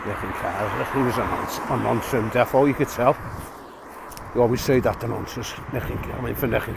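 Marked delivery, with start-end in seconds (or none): 0.1-0.9 a hint of a Scottish accent, lower and softer. To the lad in the next cubicle, a light Scottish accent. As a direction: lightly mimic a young Scot's accent. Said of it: "In a jock accent".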